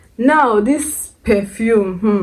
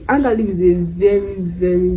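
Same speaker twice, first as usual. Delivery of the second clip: usual speaking voice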